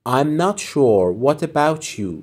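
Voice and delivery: very slow, careful teacher read; slow model phrase. Shown as a demonstration. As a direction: stretch it out for learners. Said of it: "teacher models phrase".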